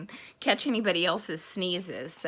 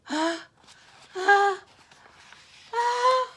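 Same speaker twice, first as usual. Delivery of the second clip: rising, louder "ah" gasps; pre-sneeze gasps; 12 semitones higher, 6 dB louder, much slower than usual. Acted. Elephant about to sneeze, building gasps. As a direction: gasp higher each time as if about to sneeze.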